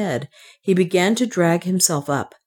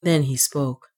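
ordinary delivery, nothing marked

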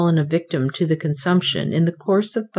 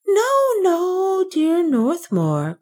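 high, slow, pleading protest; anxious cry; 12 semitones higher, much slower than usual. A narrator's reading. Banker pleads with Northmour to stop, high and anxious. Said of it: "cried the banker".

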